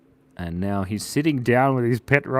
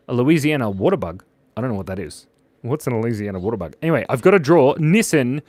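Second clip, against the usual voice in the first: normal talking voice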